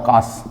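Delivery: "काश" with the श as a full final letter; full-letter pronunciation. Shown as a demonstration. Teacher contrasting a full letter with half, full-letter pronunciation. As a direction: give "काश" a long आ and a complete श. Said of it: "ये पूरे सौ का उच्चारण है".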